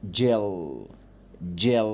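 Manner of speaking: جيل with a long, dark final L. Shown as a demonstration. Teacher showing pupils the dark L in جيل, drawn out. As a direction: hold the dark L at the end of جيل. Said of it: "بنطقها كده، ال، دارك ال".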